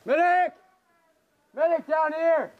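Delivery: shouting for a medic, loud and high; man down shout. Real, not acted. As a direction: yell it across the field for help. Teammate down, calling for medic.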